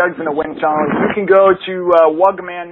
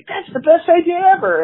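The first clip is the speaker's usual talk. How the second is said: a much higher, delighted mock gush; gushing crowd; 13 semitones higher, 4 dB louder than usual. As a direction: gush over the idea like an eager fan.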